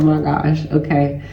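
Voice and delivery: ordinary talk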